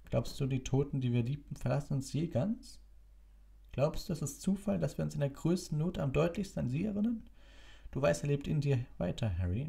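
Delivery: put-on gentle wise old wizard. A narrator's reading. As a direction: gently reassure him. Dumbledore voiced in story.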